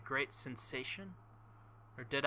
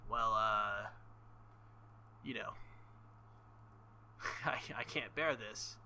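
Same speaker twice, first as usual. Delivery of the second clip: ordinary delivery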